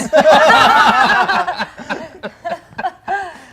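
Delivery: loud, high burst of laughter; big real laugh. Real, not acted. Storyteller laughing after the hot sauce punchline, loud and helpless. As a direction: crack up long and hard at the punchline.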